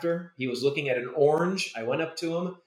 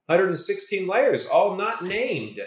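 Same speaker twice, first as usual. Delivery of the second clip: mock dismay, raised pitch, slowing at the end; imitated incredulous grumble, louder and higher; retold exasperation; 8 semitones higher, 4 dB louder, slower than usual. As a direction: complain like a put-upon animator. Animators' retold complaint on opening file.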